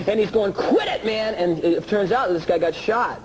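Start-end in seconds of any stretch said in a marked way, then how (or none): 0.5-1.3 high-pitched retold cry to stop; acted urgent protest. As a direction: imitate someone telling a joker to stop. Acted reply to the yelling joker.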